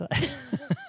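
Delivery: sudden high giggle; a quick high giggle; high burst of laughter. Real, not acted. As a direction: laugh out high at your own joke. Laughing at own joke.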